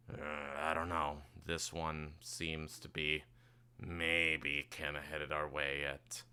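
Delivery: slow, low and soft, thinking aloud; quiet musing. Acted. Captain watching the patrol boat near, musing quietly.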